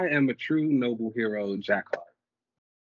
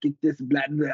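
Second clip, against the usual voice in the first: louder mock nagging voice; mocking pushy relatives; 3 semitones higher, 4 dB louder than usual. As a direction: parody the soup pushers. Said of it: "So it's like".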